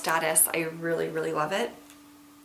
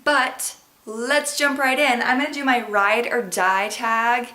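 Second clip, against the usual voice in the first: slow, louder, bright kickoff; upbeat announcer; 4 semitones higher, 6 dB louder than usual. Real, not acted. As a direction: launch the segment with energy. Energetic kickoff of the tag.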